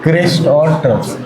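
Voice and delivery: a student's take on "crest और troughs", mildly voiced; lightly mimicking a pupil's pronunciation; imitating a student. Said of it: "क्या अलग बोलते हो आप?".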